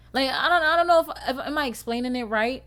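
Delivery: excited and flustered. Real, not acted. Struggling to explain music.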